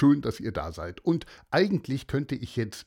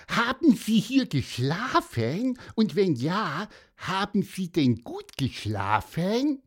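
hohe, langsame Vogelstimme; kleiner Vogel; 7 semitones higher than usual. Acted. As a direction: neugierig und hoch als kleiner Vogel fragen. Pieper fragt den Mann. (Pieper fragt Antonius, hohe Vogelstimme)

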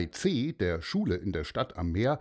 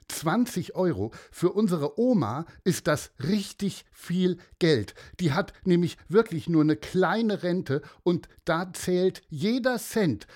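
put-on higher girl's voice, insisting; indignant girl; 8 semitones higher than usual. Girl explains to the old man why the money matters, insistent. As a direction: argue it like a stubborn nine-year-old. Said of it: "sagt sie immer".

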